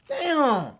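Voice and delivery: ordinary talk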